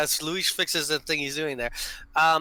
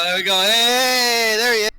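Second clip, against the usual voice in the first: louder, higher hey there he is; excited greeting; 6 semitones higher, 6 dB louder, slower than usual. Real, not acted. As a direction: cheer him on as he arrives. Greeting player joining, excited.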